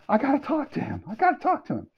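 very high, urgent retold plea; excited pleading. To sister-in-law about meeting his idol, excited pleading. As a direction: insist eagerly in a squeaky high pitch. Retold eagerness to meet Heller.

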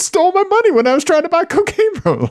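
high, fast mock 911 caller; squeaky panicked caller voice.

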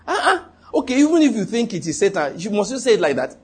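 high-pitched, put-on objection; mock protest. Voicing an objection to Jesus's harshness.

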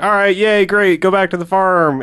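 a hammed-up hooray; mock cheer. Characters cheer, quoted.

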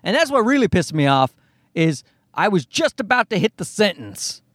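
usual speaking voice

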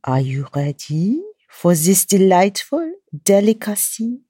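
hammed-up French chef; French accent. Chef Smiley welcomes listeners into the kitchen, playful French accent. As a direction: play a French chef with drawn-out words. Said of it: "to keep up my French accent".

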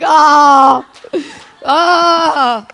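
loud, high wail of "ahh"; high drawn-out "ah". Acted. Preacher to her audience after God's reply, high drawn-out "ahh". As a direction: let out a long high "ahh". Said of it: "It's like".

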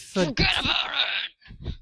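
menacing mobster threat; angry mafioso. Pretend. Trying the New Jersey line, sounding like a stabbing. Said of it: "somebody just got stabbed on that one".

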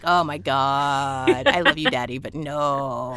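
low, very slow weary sigh; exasperated daughter. Retold or imitated. Daughter to dad, slow low exasperation. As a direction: drag it out in exasperation. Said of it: "I'm like".

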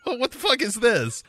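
put-on high, outraged kid voice; a kid disgusted by cheap toys; indignant kid. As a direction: squeal it like a bratty kid. Kid complaining about toys.

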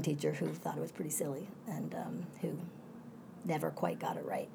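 whispered aside about the teacher; confiding whisper. Real, not acted. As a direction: drop to a whisper, sharing a secret.

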